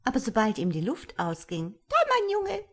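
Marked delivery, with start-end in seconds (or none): 1.9-2.6 very high, squawky imp voice; high-pitched gloating goblin; grinning malicious creature. A narrator's reading. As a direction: taunt shrilly through a grin. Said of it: "sagte es grinsend".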